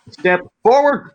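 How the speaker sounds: loud, high, slow drill command; sharp order. Retold or imitated. Corporal orders the private to step forward, loud slow command. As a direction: snap it out like a parade-ground order.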